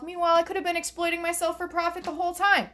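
high, fast comic rant; exasperated outburst. Acted. Comic berating self for missed profit, exasperated.

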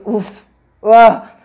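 loud relieved exhale, uf then ah; relieved sigh. Retold or imitated. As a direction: let the fear drain out in one loud breath. Alivio al dejar de sangrar. (suspiro fuerte de alivio)

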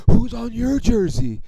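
high, loud jeer; mocking. Rival fan hitting back about the jersey, mocking. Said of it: "try to mock me".

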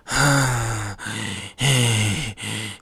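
long, soft, breathy wheeze; mock exhaustion; imitated tired sigh. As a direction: sigh out all energy. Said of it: "He's just, like, tired".

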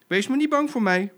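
high, loud comforting cub; big bold "don't worry" voice; hearty reassurance. Acted. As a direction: cheer up a scared friend out loud. Cub reassures the frightened zebra.

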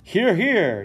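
high, loud cheering yell; crowd yell. Acted. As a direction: cheer him on loudly. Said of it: "yells the small group".

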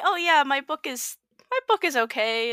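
slow, hesitant downplaying of her own book; a modest author underselling her book; unsure self-critic. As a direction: shrug it off like it's only okay. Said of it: "I am my own, like, worst critic".

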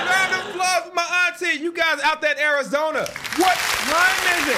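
high, bright hype-man call; rally the crowd for her; excited hype. Real, not acted. Cheering his aunt to the viewers, hype-man excitement.

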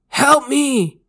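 desperate high, loud cry for help; guttural begging. A narrator's reading. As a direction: beg loudly, high and panicked. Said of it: "he begged with a guttural voice".

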